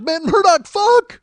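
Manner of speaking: a friend losing it with laughter; howling with glee. Retold or imitated. Toomey cracking up at bar.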